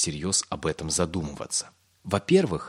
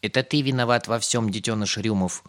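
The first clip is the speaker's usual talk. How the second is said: accusing friend; 4 semitones higher than usual. A narrator's reading. Voice in Pip's dream blames him, accusing. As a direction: lay the blame on the child. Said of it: "услышал он неожиданно знакомый голос".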